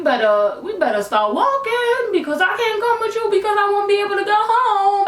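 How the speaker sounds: high, insistent chant-like warning; urgent inner plea. Retold or imitated. Girl with one bus token thinking at the boy, high and urgent. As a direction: push it out high and pressing.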